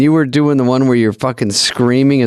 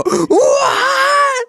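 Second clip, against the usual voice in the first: very high, drawn-out mock squeal; mock shocked disbelief; much slower than usual. Reacting to news of a cancelled sketch, mock shocked squeal. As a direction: imitate squealed, astonished questions.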